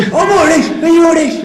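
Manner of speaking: high, loud stage-Irish yell, laughing; hammed-up Irish shout; mock Irish shout. Host shouting in a mock Irish accent, laughing. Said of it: "shit Irish accent".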